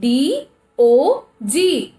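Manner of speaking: D O G sounded out letter by letter, slowly; teacher sounding out the letters of a word. Shown as a demonstration. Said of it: "where is the vowel?".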